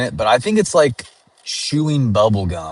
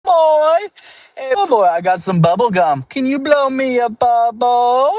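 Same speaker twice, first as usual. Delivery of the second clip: very high, louder teasing voice; mocking kids; 14 semitones higher, 4 dB louder than usual. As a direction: taunt him like a smug schoolkid. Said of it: "They'd be like".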